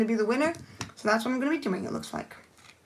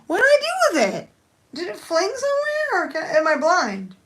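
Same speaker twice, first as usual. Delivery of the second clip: much higher and louder, bewildered squeal; baffled disbelief; 7 semitones higher, 4 dB louder than usual. Real, not acted. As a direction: squeak out where did it go. The bow has vanished.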